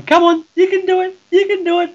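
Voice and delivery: hammed-up high-pitched pep talk; mock cheering. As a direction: egg him on like a coach in a mocking, squeaky-high voice. Mocking Triple H coaching Bryan.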